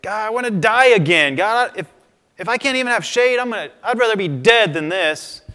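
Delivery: high, loud, whiny despair, begging to die; upset Jonah. Retold or imitated. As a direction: wail it like a sulking prophet who wants to die. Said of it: "Jonah's like".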